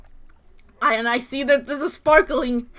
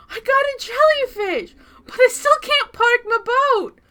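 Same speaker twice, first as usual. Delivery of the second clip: whiny; 12 semitones higher, 5 dB louder than usual. Real, not acted. Whiny complaint.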